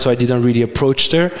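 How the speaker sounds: ordinary delivery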